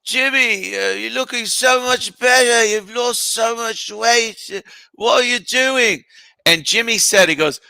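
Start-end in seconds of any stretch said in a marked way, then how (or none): 0.0-6.0 high, drawn-out rock star impression; mock rock-legend voice, pitched way up. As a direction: imitate him high and slurring with concern. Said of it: "[name] says something like".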